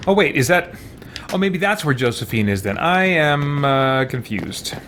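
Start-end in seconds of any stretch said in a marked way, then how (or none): none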